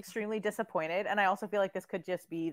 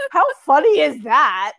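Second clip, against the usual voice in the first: loud, higher retelling of a friend's text; punchy, hammed-up amused quote; mock excited quote; 5 semitones higher, 12 dB louder than usual. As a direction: read the text out loud and gleefully. Voicing friend's text, cracking up.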